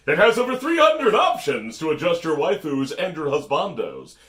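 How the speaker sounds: loud, eager sales pitch; infomercial. Pretend. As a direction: sell it like a late-night TV ad. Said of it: "Why am I reading this like an infomercial?".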